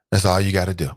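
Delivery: ordinary talk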